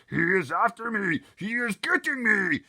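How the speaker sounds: very loud, high terrified giant scream. Acted. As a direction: scream in nightmare terror. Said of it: "screamed the Flesh Lump Eater".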